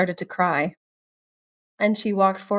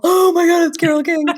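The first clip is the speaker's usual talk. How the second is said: high, loud burst of shocked excitement; excited gasp; 9 semitones higher, 7 dB louder than usual. Retold or imitated. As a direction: squeal it like you just realised. Retelling her shocked realisation.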